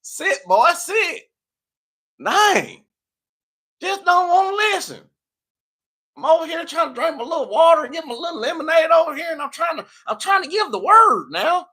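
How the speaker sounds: high, loud Southern preacher scolding; country preacher voice. Pretend. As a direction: preach it hard with a thick country accent. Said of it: "let me get out of this accent".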